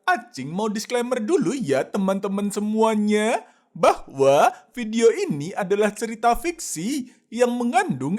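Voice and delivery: a quick, light host aside; friendly disclaimer read. Acted. Host Acing tells viewers the video is fiction, as a light host read.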